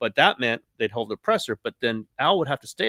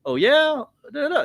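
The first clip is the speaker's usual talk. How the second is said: teasing challenge; 14 semitones higher, 4 dB louder than usual. Retold or imitated. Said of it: "A little bit of, like".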